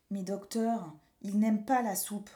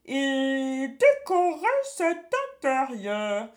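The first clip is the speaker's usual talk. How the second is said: slow, loud sly witch; a witch redecorating gleefully; sneaky cartoon thief; 3 semitones higher, 5 dB louder, slower than usual. A narrator's reading. Witch scheming inside belly.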